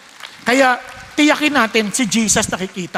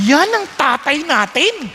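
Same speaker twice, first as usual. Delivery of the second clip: ordinary delivery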